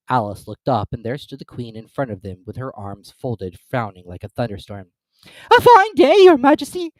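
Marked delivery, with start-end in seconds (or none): none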